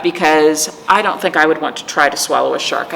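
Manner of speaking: usual speaking voice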